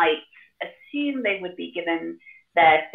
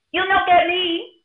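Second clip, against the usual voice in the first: high, slow, louder piggy taunt; defiant piggy cry; 12 semitones higher, 5 dB louder, slower than usual. Acted. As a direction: cry it out as the cocky little pig. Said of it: "the piggy cried".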